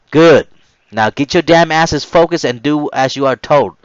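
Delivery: loud, high barked orders; loud encouragement. Retold or imitated. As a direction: push the recruits inside. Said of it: "he loudly encouraged us onward".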